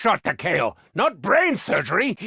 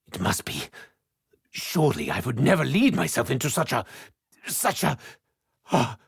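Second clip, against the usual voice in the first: ordinary talk, nothing marked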